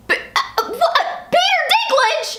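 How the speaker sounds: stammer, then high-pitched squeal; splutter, then blurt it out very high; starstruck shock. Retold or imitated. Fan seeing Peter Dinklage on screen, stammering shock. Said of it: "I was just like".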